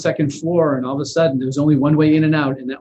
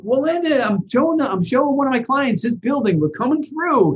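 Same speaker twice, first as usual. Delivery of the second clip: much higher retold explanation to a tenant; lightly hammed broker voice, much higher; imitating the broker; 11 semitones higher than usual. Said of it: "And she's like".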